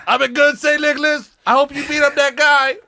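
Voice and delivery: put-on high, loud, eager kid voice; hammed-up kid pleading with Saint Nicholas; eager child. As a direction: beg like a child on Christmas Eve.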